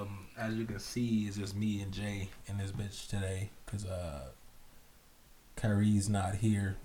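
slow, softened, solemn announcement; grave and subdued, with heavy pauses; somber. Real, not acted. Co-host tells listeners why a friend is missing, somber. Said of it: "that's like somber as fuck".

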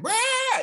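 very high screechy monster "rah"; imitated monster roar. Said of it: "and he's like".

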